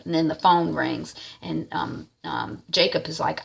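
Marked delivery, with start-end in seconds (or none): none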